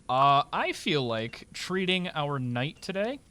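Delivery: ordinary talk, nothing marked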